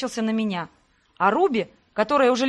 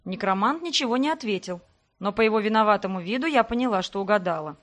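plain reading voice

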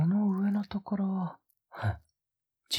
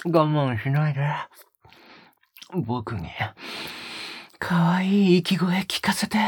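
breathy close-mic whispers with a teasing edge; a lover's hushed, teasing murmur; intimate ASMR whisper; 3 semitones lower than usual. Acted. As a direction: whisper right into the listener's ear, coaxing. Intimate whispered roleplay throughout.

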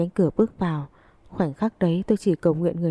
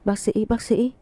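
mild, higher-pitched call for a doctor; 4 semitones higher, slower than usual. A narrator's reading. Mother calls for a doctor at the hospital, restrained calling out. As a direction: call out urgently without really shouting. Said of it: "gọi lớn".

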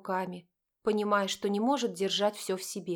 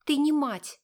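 accusing; 6 semitones higher, 5 dB louder, slower than usual. A narrator's reading. Daughter's accusation.

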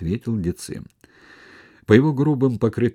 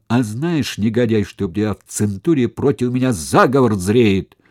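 menacing accusation; 4 semitones higher, 7 dB louder than usual. Acted. Sula threatens Decimus.

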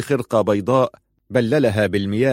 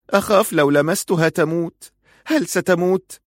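high, anxious mother's voice; hesitant fear; 8 semitones higher than usual. A narrator's reading. Mother afraid to touch her daughter, high hesitant voice. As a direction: ask it fearfully, pitched well up. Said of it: "وقالت في تردد".

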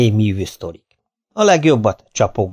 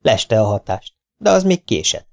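plain reading voice

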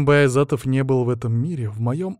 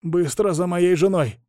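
slow, higher-pitched effortful command; strained order; 6 semitones higher, slower than usual. A narrator's reading. Hostage sending his man for his wife, straining.

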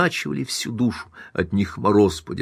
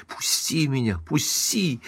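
high, softer strained protest; struggling cries; 5 semitones higher, 6 dB quieter, slower than usual. A narrator's reading. Wife held back by husband, high struggling cries. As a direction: demand release while struggling. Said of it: "продолжала вырываться и кричать".

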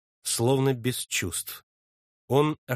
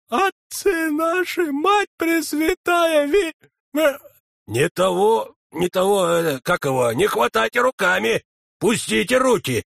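begging through the pain; panicked sexton; 8 semitones higher, 5 dB louder than usual. A narrator's reading. Дьячок в панике от боли. (высокий испуганный голос)

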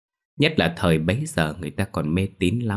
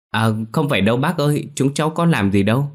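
fast, higher, louder protest; hurried denial; 6 semitones higher, 3 dB louder than usual. A narrator's reading. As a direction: rush to deny it. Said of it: "vội vàng xua tay chối bỏ".

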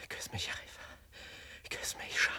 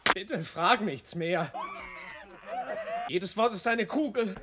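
desperate plea; 5 semitones higher, 7 dB louder, slower than usual. Acted.

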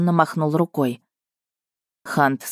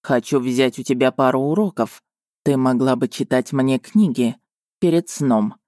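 lightly lowered, suggestive prince; 3 semitones lower than usual. A narrator's reading. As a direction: drop the voice, hint at something. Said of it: "нарочно понижая голос". (нарочно пониженный многозначительный голос)

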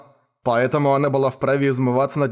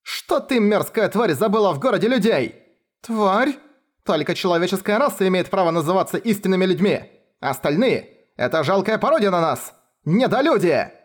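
high, spiteful teacher's tirade; hateful spitting; 6 semitones higher than usual. A narrator's reading. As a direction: spit the words out with hatred. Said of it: "с ненавистью выплюнула".

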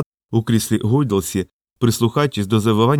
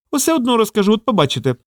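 high-pitched defiant squirrel, mildly shouted; high little tattletale voice; squirrel's defiant cry; 10 semitones higher than usual. A narrator's reading. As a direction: call it out high, falling at the end. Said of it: "кричала пампушка".

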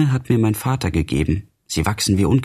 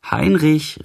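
high-pitched call for a missing son; 7 semitones higher than usual. A narrator's reading. Old mother calls her son Heinrich to dinner, calling out. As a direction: call out the name, voice raised in pitch. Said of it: "rief eine alte Frau".

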